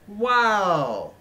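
loud, high, drawn-out wow of disbelief; floored by the news; stunned wow. Real, not acted. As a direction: react in open astonishment. Stunned reaction to new agent.

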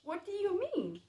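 curious. Acted. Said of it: "very curious".

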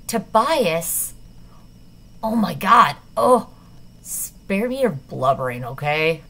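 loud sudden cry that turns scornful; startled outburst. Acted. Peter mocking Tobias's alarm, loud then scornful. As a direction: blurt it out, then sneer.

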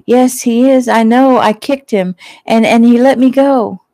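high, loud frantic protest; frantic insisting. A narrator's reading. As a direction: push back in panic, voice climbing. Frightened woman insists attacker was there.

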